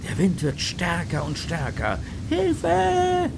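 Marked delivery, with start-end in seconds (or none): 2.3-3.3 high, loud cry for help; a child's panicked scream. Acted. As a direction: scream it, swept up by the storm. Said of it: "schreit Hannah".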